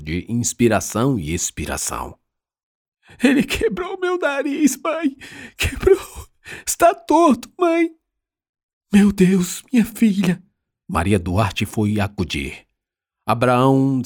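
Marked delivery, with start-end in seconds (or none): 3.2-7.9 very high-pitched distressed wail; tearful wail. A narrator's reading. Injured daughter crying to her mother over her broken nose, tearful wail. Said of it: "só não chorava mais alto". 8.9-10.4 high, dismayed mother's cry; horrified exclamation. A narrator's reading. Mother seeing her daughter's broken nose, horrified exclamation.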